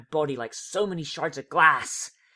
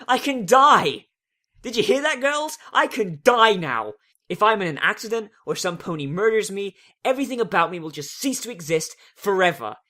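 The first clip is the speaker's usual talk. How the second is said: elated shout; 6 semitones higher, 8 dB louder than usual. Acted. Twilight telling her friends she is free, elated shout. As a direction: cry out in giddy relief.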